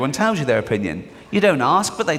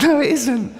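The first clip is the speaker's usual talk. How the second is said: laughing retort; 11 semitones higher than usual. Retold or imitated. Mocking the teacher's claim.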